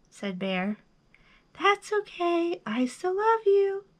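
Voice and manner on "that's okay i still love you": high, gentler echoed reply; high voice, held back. A narrator's reading. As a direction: repeat it like a faraway echo. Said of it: "his own voice echoed".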